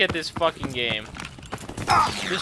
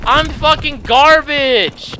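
loud, high, drawn-out complaint; frustrated self-reproach; 7 semitones higher, 5 dB louder, much slower than usual. Real, not acted. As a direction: berate yourself loudly, dragging the words. Gamer curses own play.

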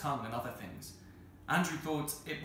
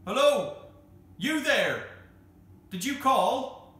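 loud, high hail into the distance; shouting; 12 semitones higher, 11 dB louder, slower than usual. A narrator's reading. Andrew hails unseen yellers in the dark, shouting. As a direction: holler up to someone far away, drawn out. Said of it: "Andrew shouted".

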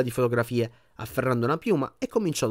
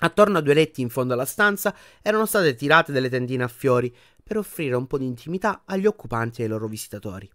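plain reading voice

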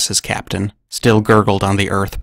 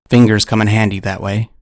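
ordinary delivery

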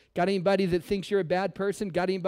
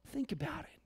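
hushed whisper; 6 semitones higher, 13 dB quieter than usual. Real, not acted. Pastor whispering to church for effect. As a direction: drop to a whisper.

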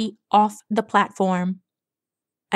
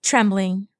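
ordinary delivery, nothing marked

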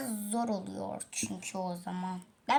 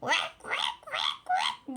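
breathy "Vak, vak" then a very high squawk; bird call imitation; much slower than usual. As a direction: imitate the big bird's call as it cries. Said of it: "Ben de onun gibi bağırıyorum".

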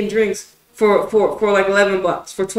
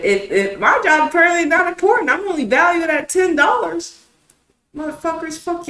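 louder, higher, heated complaint; angry rant; 9 semitones higher, 5 dB louder than usual. Real, not acted. Telling viewers his job was undervalued, a heated rant. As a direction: vent it like you're fed up. Ranting about being undervalued.

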